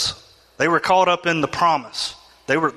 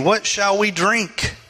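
complaining people; 5 semitones higher, slower than usual. Retold or imitated. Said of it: "the people complained against Moses, saying".

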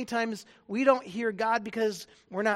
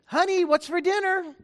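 raised call from the next room; calling again; 7 semitones higher, 6 dB louder than usual. Acted. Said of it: "go into the living room and then say again".

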